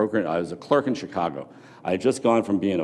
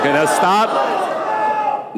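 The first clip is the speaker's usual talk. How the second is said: slow, drawn-out call over the crowd; call to stop; 11 semitones higher, 5 dB louder, much slower than usual. Real, not acted. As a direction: bring the noisy room to a halt. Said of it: "calling the room to stop".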